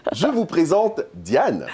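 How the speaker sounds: laughing through the intro; slow, chuckling as she names her co-host. Real, not acted. Host introducing her co-host Diane, laughing.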